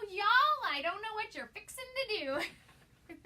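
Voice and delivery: mock Southern drawl, high and loud on Y'all; playful put-on Southern accent with a big high y'all. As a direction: ham up a Southern expression, slowly. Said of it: "makes me wanna say".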